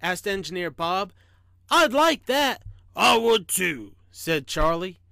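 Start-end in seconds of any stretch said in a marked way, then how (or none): 1.7-2.6 high, louder, pleased engineer; raised storybook enthusiasm; eager. A narrator's reading. Engineer pleased to have talking friend. 3.0-3.9 gruff little talking train; small, gruff storybook voice. A narrator's reading. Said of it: "small, gruff voice".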